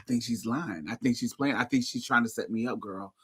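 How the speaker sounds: soft mumbled imitation of a paranoid friend, a bit fast; lightly mock her friend's conspiratorial murmur; hint of a paranoid friend, quiet; imitating Vanessa. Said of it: "This is how she talk".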